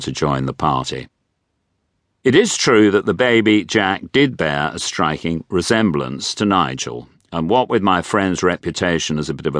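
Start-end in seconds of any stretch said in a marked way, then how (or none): none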